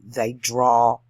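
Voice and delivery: drawn-out, emphatic drawl; slow Southern drawl. Shown as a demonstration. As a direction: drag out draw and drawl, loudly. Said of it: "they talk slowly".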